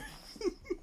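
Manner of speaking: airy laugh at himself; high breathy laugh. Real, not acted. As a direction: let a squeaky laugh escape. Laughs at own story.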